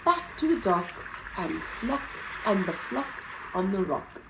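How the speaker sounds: usual speaking voice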